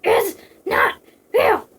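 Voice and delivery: loud, high shout, word by word. Acted. Operative on his knees refusing the vision, drawn-out shout. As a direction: shout each word slowly and separately. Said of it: "fell to his knees and shouted".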